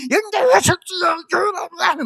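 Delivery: high, strained, drawn-out yelling; mock parent screaming from the sidelines; frantic cheering parent. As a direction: holler at your child on the track. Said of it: "막 이름을 부르는데".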